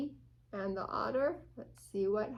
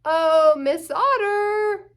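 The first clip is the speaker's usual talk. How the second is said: higher, louder, slow sing-song greeting; bee voice; 7 semitones higher, 14 dB louder, slower than usual. Acted. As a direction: greet the otter like a cheery buzzing bee. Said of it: "here comes the bee".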